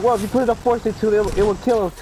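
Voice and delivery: high, fast mom arguing; quick high mom reasoning; hammed-up mom insisting. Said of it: "she was like".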